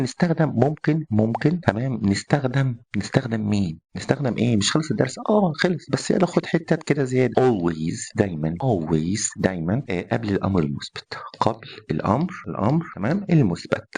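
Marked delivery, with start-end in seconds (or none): none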